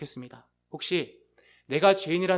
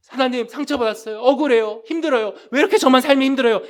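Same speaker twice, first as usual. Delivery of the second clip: high, loud whiny plea, rushing faster at the end; self-pitying complaint; 7 semitones higher, 11 dB louder than usual. Pretend. Preacher mimics a self-pitying believer complaining to God, higher, louder and faster. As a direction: pour out self-pity like a wronged child.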